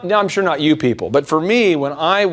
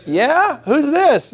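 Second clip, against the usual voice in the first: lightly voiced puzzled friend; 9 semitones higher, much slower than usual. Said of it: "And you're like".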